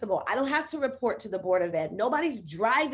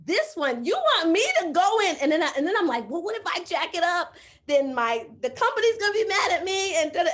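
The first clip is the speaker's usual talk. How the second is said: anxious; 9 semitones higher, 6 dB louder than usual. Retold or imitated. Retelling her anxious worry.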